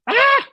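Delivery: sudden high squeal of delight. Real, not acted. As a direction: squeal with joy at your work. Gasp of delight at finished bracelet.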